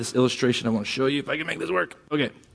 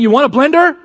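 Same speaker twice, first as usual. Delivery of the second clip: higher and louder, hammed-up shopping channel hawker; mock TV salesman pitch; 11 semitones higher, 7 dB louder, faster than usual. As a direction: bark the offer like a Home Shopping Channel host. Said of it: "it's like saying".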